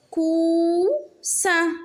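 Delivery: "Coussin" split into cou, ssin; syllable by syllable. Shown as a demonstration. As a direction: hold "cou", pause, then "ssin" in "Coussin". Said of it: "Frappe les syllabes". (« Coussin » en deux syllabes bien détachées)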